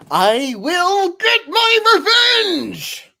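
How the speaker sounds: high, loud, slow theatrical revenge shout; villain vow. Acted. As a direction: roar it like a cartoon villain.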